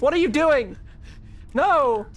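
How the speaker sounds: ordinary delivery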